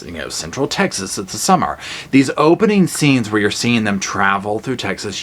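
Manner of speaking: ordinary talk, nothing marked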